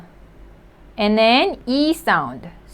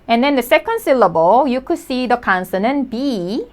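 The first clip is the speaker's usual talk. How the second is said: usual speaking voice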